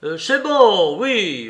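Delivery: a loud, conciliating yell; shouted call. A narrator's reading. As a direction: shout it out to the next room. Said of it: "il changea de ton pour crier".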